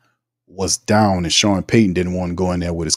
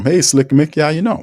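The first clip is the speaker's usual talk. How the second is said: usual speaking voice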